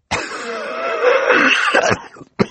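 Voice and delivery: acted, spluttering coughing fit. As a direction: cough and splutter before you can speak. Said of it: "he coughed into a handkerchief".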